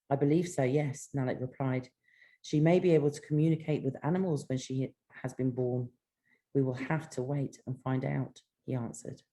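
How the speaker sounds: calm, soft reading of her novel; story narration. Acted. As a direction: read the chapter gently, like an audiobook. Author reads her novel aloud.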